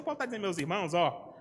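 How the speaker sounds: ordinary talk, nothing marked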